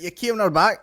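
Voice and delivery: mimicking Scottish guy. Retelling what the man in the clip says, mock Scottish. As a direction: echo the Scottish guy, amused. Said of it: "He's like".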